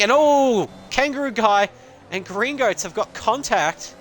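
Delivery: high, loud burst on the oh, drawn out; sudden jump up in pitch as contact happens; excited race call. Real, not acted. Commentator calls a sudden collision to viewers, excited.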